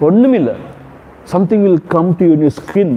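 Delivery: ordinary talk, nothing marked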